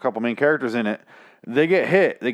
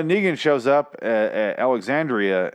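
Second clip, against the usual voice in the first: usual speaking voice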